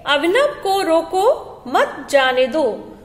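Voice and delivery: "रोको" drawn out and rising, then a break before "मत"; pause after रोको. Shown as a demonstration. Teacher showing pupils what a comma does, pausing after "रोको". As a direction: stop after "रोको", then a slow, falling "मत जाने दो". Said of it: "पहले वाले में रोको के बाद चिन्ह लगा है".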